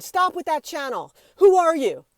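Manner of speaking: high, loud imitation of an angry man; aggressive. Store worker confronts the apologizing YouTuber, aggressive. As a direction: cut her off, building to a loud challenge. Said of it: "he was speaking to me really aggressively".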